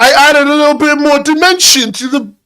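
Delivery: high, loud protest; exasperated outburst. Real, not acted. Defending the drawing fix to a partner, exasperated. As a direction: burst out, pitched right up.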